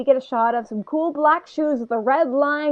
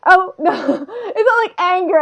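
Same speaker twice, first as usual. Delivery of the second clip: higher, amused denial; laughing; 7 semitones higher, 3 dB louder than usual. Real, not acted. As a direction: protest through a laugh. Laughing while denying real anger.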